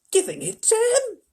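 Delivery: very high, loud incredulous gasp; Grandpa Joe. A narrator's reading. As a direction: gasp the question in disbelief. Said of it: "gasped Grandpa Joe".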